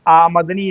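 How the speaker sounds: आमदनी as आमद-नी, म keeping its vowel; clear pronunciation. Shown as a demonstration. Teacher modelling for students, आमदनी clearly as आमद-नी. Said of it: "इसको पढ़िए साफ साफ".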